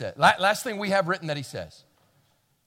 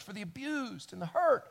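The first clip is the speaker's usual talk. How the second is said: usual speaking voice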